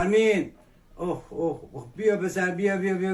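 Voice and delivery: low deep voice. Acted. Character calls boy over.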